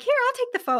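high, bright eager offer; helpful offer. Retold or imitated. Offering to photograph fans with him, high eager chirp.

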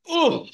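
pained groan. Retold or imitated. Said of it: "And I was like".

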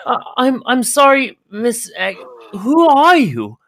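high, startled servant voice, slowing at the end; a nervous young man caught out; flustered servant. Acted. Servant stammers back at the angry old woman, high and startled. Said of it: "you hear Welsh's voice say".

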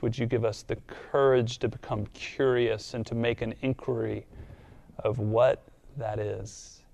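soft, unhurried praying voice; hushed closing prayer to God; quiet prayer. Real, not acted. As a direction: pray it low and tender to God.

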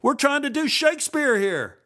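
very high, strained indignant yell; yelled rant. A narrator's reading. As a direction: rant at the top of the voice. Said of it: "Jim continued".

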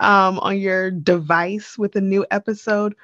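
normal talking voice

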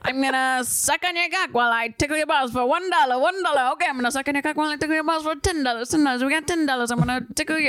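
high, rattling auctioneer chant; fast auctioneer. Acted. Auctioneer for a fetish client, fast high-pitched patter. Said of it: "Say it faster".

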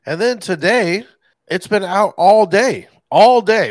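real frustration building to a shout; angry rant. Real, not acted. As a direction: vent it, hitting all day hard. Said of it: "I'm pissed".

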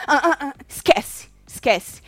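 "ah, ah, ah" arrastado, cortando o assunto; cortando a fala. Retold or imitated. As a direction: encerra a conversa sem deixar continuar, devagar. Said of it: "quando ela não deixa o povo continuar". (Yasmin interrompendo quem fala, cortando devagar)

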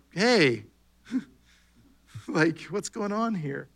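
breezy and amused, like dropping in on friends; Jesus walking in on the scared disciples, laughing lightly; casual laughing greeting. Pretend. Jesus walks through wall casually.

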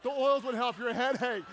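hammed-up I-told-you-so; mock retort. Wife's retort in comic story.

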